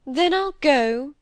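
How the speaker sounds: high, drawn-out martyred sigh of consent; slow, self-pitying resignation; victim voice. A narrator's reading. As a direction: give in like a long-suffering victim. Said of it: "in the voice of a victim".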